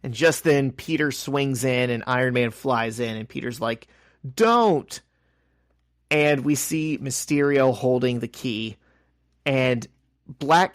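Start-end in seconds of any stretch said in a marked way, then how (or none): 4.3-5.0 shouted warning. Retold or imitated.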